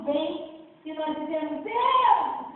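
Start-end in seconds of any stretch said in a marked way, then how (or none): 1.6-2.5 raised and louder, a dramatized cry to God; mock loud plea. Believers crying out when the enemy comes, raised and loud.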